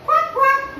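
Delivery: very high-pitched duck quacks; playful squeaky ducky voice. Shown as a demonstration. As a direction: quack like a little duck for children. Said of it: "do you like a ducky?".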